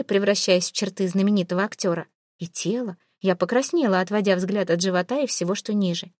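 ordinary delivery

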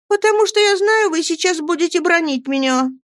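high, pitiful little wail; plaintive cry. A narrator's reading. Dora expects a scolding from husband, plaintive. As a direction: exclaim plaintively, pitched high like a child. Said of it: "жалобно воскликнула Дора".

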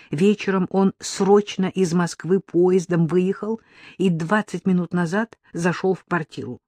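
usual speaking voice

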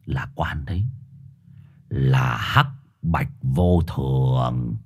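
deep, slow, dreadful hush; ominous low dog voice. Acted. Mixed dog naming the death spirits, slow and low. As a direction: drag out the name like a curse.

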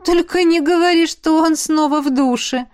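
high, loud flustered exclamation; embarrassed outcry. Acted. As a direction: blurt it out, cheeks burning. Смущённая героиня вспоминает душ. (смущённый возглас, высоко и громко)

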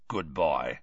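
low growled approval; Moody growling. A narrator's reading. Moody approving Harry's loan of the map, growling. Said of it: "growled Moody".

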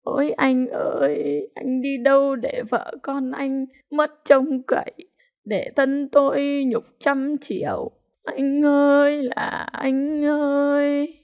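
high, slow, sing-song keening; wailing cry. A narrator's reading. Widow calls out to her husband, wailing in grief. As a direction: draw out each cry in mourning. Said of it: "nhớ đến chồng chị gào".

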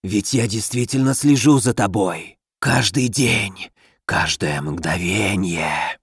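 rustle it like a dead thing speaking; inhuman rustling voice. Acted. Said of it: "зашелестел нечеловеческий голос".

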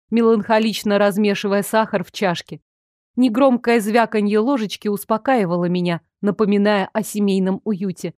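ordinary delivery

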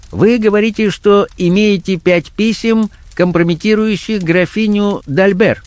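high-pitched blackmailer voice talking business; businesslike blackmailer. Acted. Blackmailer negotiating to buy compromising letters, businesslike. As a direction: set out the deal in a high character voice.

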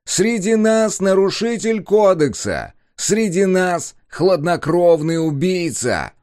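booming slow public address announcement; loudspeaker voice. A narrator's reading. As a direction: proclaim it through a loudspeaker. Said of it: "прогремел голос из громкоговорителя".

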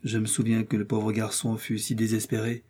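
usual speaking voice

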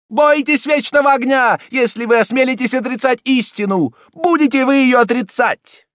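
high, loud furious cardinal; angry shouting. A narrator's reading. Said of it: "Всё более и более гневно кричал".

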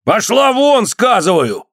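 very high, forceful dismissal; acted official losing patience; angry shout. A narrator's reading. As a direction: order her out angrily. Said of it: "крикнул подьячий, сердясь".

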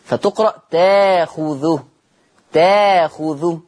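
تاخذه drawn out slowly with a long open ta-a; slow recitation demo of تاخذه; hamza changed to alif. Shown as a demonstration. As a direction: stretch تاخذه with no glottal stop, ta-a-khuthu. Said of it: "فتبدل ألف".

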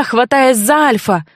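plain reading voice

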